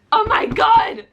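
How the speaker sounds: high, loud anguished cry; real shocked outcry. Real, not acted. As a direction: let it burst out as a wail. Shocked reacting to book twist.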